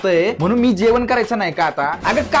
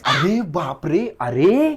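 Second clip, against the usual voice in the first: slow low drawn-out gasp of surprise; hammed-up amazement, slow; mock shock; 5 semitones lower, much slower than usual. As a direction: fake being stunned, slowly. Mock shock at her eating.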